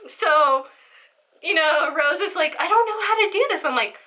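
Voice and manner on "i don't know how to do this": high-pitched, fast and flustered; high panicky voice. Retold or imitated. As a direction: squeak it out in a panic. Friend Rose panicking, quoted.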